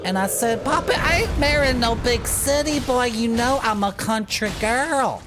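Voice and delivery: high, hammed-up Southern country girl; super southern accent. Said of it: "until we get super southern accents".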